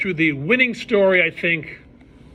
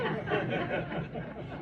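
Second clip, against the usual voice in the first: soft breathy laugh; 4 semitones higher, 11 dB quieter than usual. Real, not acted. Laughs at punchline.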